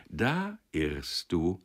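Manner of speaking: "Da irrst du" slow and weighty; firm, drawn-out contradiction on "Da irrst du"; emphatic. A narrator's reading. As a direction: press out "Da irrst du" word by word. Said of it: "erwiderte der Marschallik nachdrücklich".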